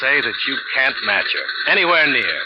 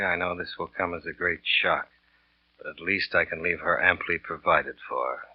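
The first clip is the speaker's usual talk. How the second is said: reading a note aloud; 3 semitones lower, 4 dB quieter than usual. Acted. Killer reading the forged note to the wife, hushed. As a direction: read the forged note quietly, word by word.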